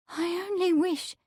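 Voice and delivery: high, soft, trembling maid. A narrator's reading. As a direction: start haltingly, voice trembling. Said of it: "she began tremblingly".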